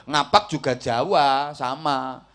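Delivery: ordinary delivery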